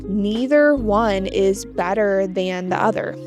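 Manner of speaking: ordinary talk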